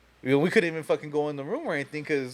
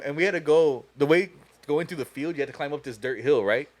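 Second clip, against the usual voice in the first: usual speaking voice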